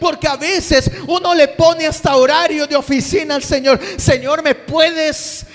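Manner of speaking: loud, high, driving sermon shouting; preaching at full force. Acted. Preacher calling the congregation to answer God, shouting. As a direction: preach it hard to the whole congregation.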